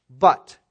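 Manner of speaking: "but" punched very loud, standing alone; strong contrastive BUT. Shown as a demonstration. Preacher marking Jesus' contrast, "but" stressed loudly. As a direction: hit "but" hard as the turning point. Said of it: "strongest possible word for a contrast".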